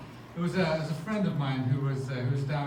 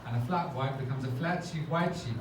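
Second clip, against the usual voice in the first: normal talking voice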